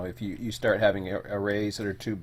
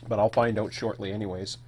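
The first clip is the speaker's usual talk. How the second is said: usual speaking voice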